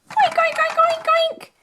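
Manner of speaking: high, squeaky little oinks; piggy oink. Pretend. Runaway toy pig taunting, high squeaky oinks. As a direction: oink like a cartoon piglet.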